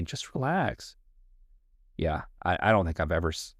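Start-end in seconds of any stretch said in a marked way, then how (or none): 0.0-1.0 high soft mocking just relax; pitched-up patronizing hush; mock soothing. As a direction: mimic a patronizing calm. Mocking the pacifying phrase.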